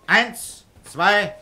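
counted out loud and high; loud counting. Acted. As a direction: count him out, firm and loud. Counting boy out of hiding.

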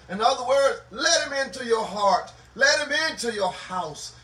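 driving, rhythmic preacher's delivery; impassioned preaching. Real, not acted. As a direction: exhort the congregation with rising fervor. Preacher exhorting a congregation.

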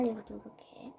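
quiet whispered aside. Real, not acted. Crafter whispering mid-task to viewers. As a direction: murmur it under your breath while working.

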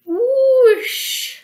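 high, loud rushing-water whoosh; big loud whoosh; whoosh sound effect. Acted.

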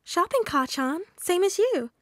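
high, sugary lilt; sweet. A narrator's reading. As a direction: sweetly tease with a smile in the voice. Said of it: "Izuku smiled sweetly".

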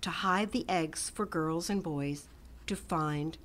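usual speaking voice

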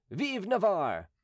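high, drawn-out battle cry. A narrator's reading. Huguenot gentlemen following their king, crying out.

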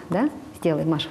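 ordinary talk, nothing marked

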